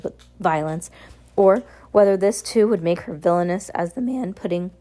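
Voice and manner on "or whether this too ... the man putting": ordinary delivery, nothing marked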